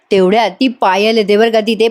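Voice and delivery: ordinary delivery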